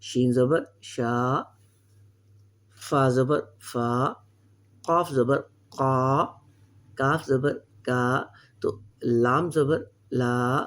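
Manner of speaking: slow, stretched syllables, each vowel held long; slow reading drill. Shown as a demonstration. Teacher reading letters aloud for students to copy, slowly. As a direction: spell out را, زا, سا slowly with a drawn آ. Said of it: "میں بیچ بیچ میں سے کچھ کچھ پڑھ دیتا ہوں".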